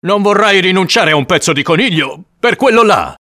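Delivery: loud, high-pitched disbelief; incredulous protest. Acted. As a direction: object as if she were mad. Man incredulous at her refusal.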